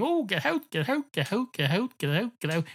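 high-pitched, rapid-fire barman shooing. Acted. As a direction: chase a dog out of the bar, pitch dropping as you repeat. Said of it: "the barman going".

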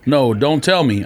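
ordinary talk

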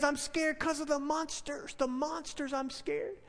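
very soft imitation of a frightened child; scared little boy. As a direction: play a small boy hushed with fear. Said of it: "this little boy".

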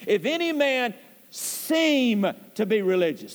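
pause, then a long weighted "seem"; stress on SEEM. Shown as a demonstration. Preacher underscoring a word in the verse, emphatic stress on "seem". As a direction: set "seem" apart and hold it. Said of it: "I emphasize it".

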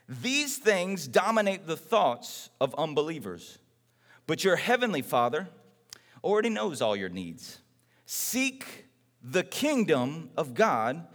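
low, slow and soft, reading scripture; hushed reverent scripture reading; solemn scripture reading. Acted. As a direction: let the verse settle, low and hushed.

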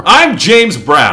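slow soul-singer yell; [name] shout. Pretend. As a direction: belt the name like [name]. Mock showman sign-off.

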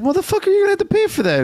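high, fast yell at the shoplifter; mock angry yell. Shopkeeper yelling at a thieving customer, mock angry.

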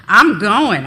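loud, insistent declaration; defiant. A narrator's reading. Teen girl defying her mother, loud and insistent. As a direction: plant your feet and refuse to be stopped.

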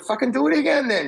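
wife telling her husband to just redo the race, loud and high; wife's retort. Retold or imitated. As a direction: fire it back as the exasperated wife. Wife snaps back in story.

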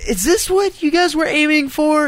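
high, pleading question to parents; mock earnest plea. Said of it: "show them and then be like".

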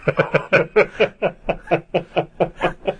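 a long, real laugh. Real, not acted. Laughs at merman mix-up.

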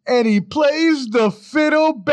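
high, loud announcer build-up; announcer shout. Acted. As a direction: belt it like a ring announcer. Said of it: "ending outtake".

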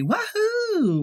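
high gleeful whoop; wahoo cheer. Retold or imitated. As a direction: mimic a cast cheering. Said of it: "did all of them get together and say".

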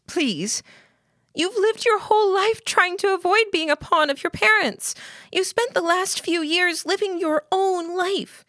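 put-on high-pitched, urgent girl pleading; pleading Lillian.

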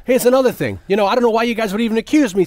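high, fast, indignant rant; on his high horse. Retold or imitated. Balsam lecturing detectives, high, fast and indignant. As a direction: get self-righteous and rattle it off. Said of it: "on his high horse".

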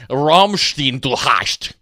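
"Rammstein, du hast" with German ah and sh sounds; hammed-up German "Rammstein, du hast"; German pronunciation. Shown as a demonstration. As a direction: say "Rammstein" as rahm-shtine, "hast" as hahsht. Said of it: "if you try to play Rammstein by going".

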